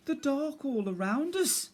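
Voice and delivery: high small timid voice; small scared bear cub. Acted. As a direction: speak as a frightened little cub.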